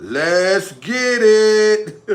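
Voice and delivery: very slow, elongated rallying catchphrase; drawn-out hype call. Pretend. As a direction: stretch every word right out. Drawn-out hype catchphrase after repeats.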